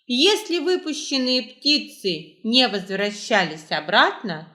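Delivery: ordinary delivery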